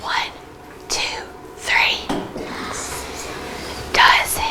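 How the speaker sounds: soft unvoiced whisper for the class; hushed classroom whisper. Shown as a demonstration. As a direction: whisper the count, then the word. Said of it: "In a whisper voice".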